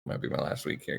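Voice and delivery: mock-doomed whisper. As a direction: breathe it like a last farewell. Joking doom after candy corn.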